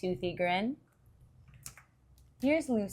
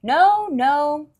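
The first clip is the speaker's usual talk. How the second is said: a firm, louder and higher no; loud refusal; 6 semitones higher, 8 dB louder than usual. Acted. Charlie Brown refusing Lucy's offer, loud and firm. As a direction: slam the door on her with one word.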